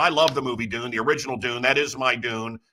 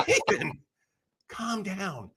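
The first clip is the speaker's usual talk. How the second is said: soft mock-placating murmur; hushed calming; 3 semitones higher, 6 dB quieter, slower than usual. Calming a friend who wants to fight, hushed. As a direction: gently talk him down, much softer. Hushing his enraged friend.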